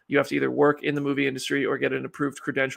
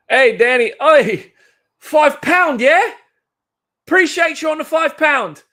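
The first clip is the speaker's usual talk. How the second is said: high, shouted British geezer bit; mock British accent; 13 semitones higher, 12 dB louder, slower than usual. Streamer thanking Danny for five pounds, shouted mock British accent. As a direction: holler it in a hammed-up British accent.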